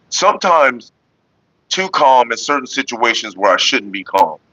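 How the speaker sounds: usual speaking voice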